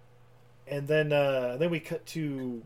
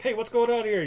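higher, louder, startled officer; mock alarmed shout; 9 semitones higher, 5 dB louder than usual. Lieutenant bursts into the room, a startled raised call. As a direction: burst in demanding answers.

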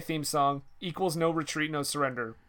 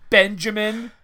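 stern full-name scolding; 4 semitones higher, 8 dB louder than usual. Pretend. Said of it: "I used his full name".

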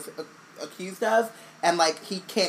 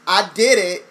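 louder, breezy fake confession; mock villain confession; 6 dB louder than usual. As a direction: toss off the confession like it's nothing. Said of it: "someone has basically been like".

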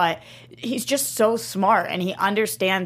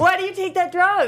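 high yelled outburst of disgust; disgusted shout; 12 semitones higher, 3 dB louder than usual. Real, not acted. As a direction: shout it, grossed out. Reacting to gross drug image.

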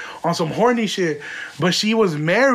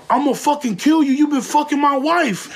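higher-pitched imitated threat from the husband; angry threat; 8 semitones higher than usual. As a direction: play the furious husband calling the store. Jealous husband threatening on phone.

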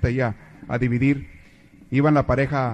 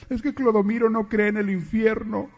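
daughter confessing; 7 semitones higher than usual. Acted.

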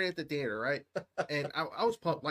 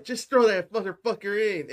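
loud mock cheer; 5 semitones higher, 9 dB louder than usual. Fan yelling at Frodo in the theater, loud mock cheer. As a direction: belt it out like yelling at the screen.

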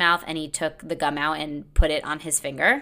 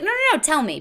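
eager begging; 11 semitones higher than usual. Retold or imitated. Begging date to tell her.

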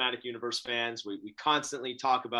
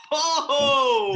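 laughing; 12 semitones higher, 8 dB louder than usual. Real, not acted. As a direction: burst of high laughter, Oh!. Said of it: "laughs Oh".

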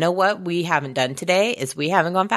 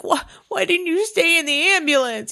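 whiny villain; 12 semitones higher than usual. Retold or imitated. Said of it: "he's like".

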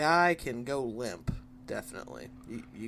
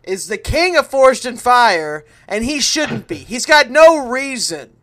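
loud, raised, heated grievance; indignant rant; 6 semitones higher, 11 dB louder than usual. Real, not acted. Fan complaining to his friend about the champ, indignant. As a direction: rail against an unfair champion.

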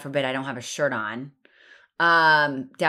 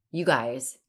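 ordinary delivery

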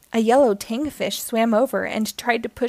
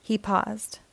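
ordinary delivery, nothing marked

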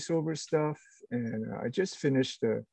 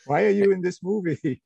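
higher, louder, half-laughing protest; mock exasperated yell; 7 semitones higher, 6 dB louder than usual. Teasing Michael Caine about his film choices, mock yell. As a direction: shout it like a baffled fan teasing. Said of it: "you just go".

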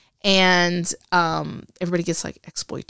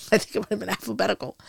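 normal talking voice

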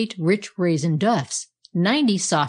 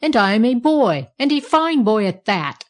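raised, boastful yell; yelled protest; 4 semitones higher, 5 dB louder than usual. A narrator's reading. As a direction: loudly insist on it. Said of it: "he yelled".

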